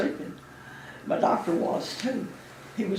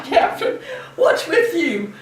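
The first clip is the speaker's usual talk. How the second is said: high, loud, laughing tease; laughing teasing; 9 semitones higher, 6 dB louder, slower than usual. Retold or imitated. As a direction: rib the captain while laughing. Retold teasing of captain, laughing.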